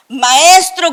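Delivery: a sharp cry of Maestro, high and loud; shouted plea. Retold or imitated. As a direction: call out to the teacher in panic.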